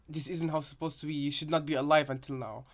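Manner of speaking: ordinary talk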